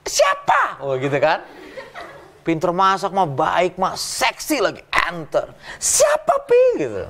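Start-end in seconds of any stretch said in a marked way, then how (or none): 0.1-0.8 much higher sharp mock wife; wife suspicious of the WhatsApp message. Wife voiced in a joke. 5.8-6.8 much higher sharp mock wife; wife suspicious of the WhatsApp message. Wife voiced in a joke.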